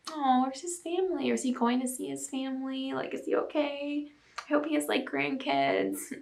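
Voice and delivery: small, pitying voice full of sympathy; tender concern. Acted. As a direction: fret sweetly and quietly over someone. Voices worry for lonely old man.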